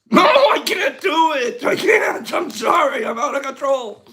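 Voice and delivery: high, loud, out-of-control protest; giddy giving up. Real, not acted. Host giving up the John Wayne impression, giddy high outburst. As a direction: burst out high and loud, abandoning the act.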